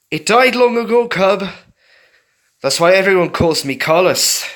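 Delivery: loud, high, gloating fox voice; mock the cub with his dead compassion; sneering old fox. A narrator's reading. Carlos the cruel fox.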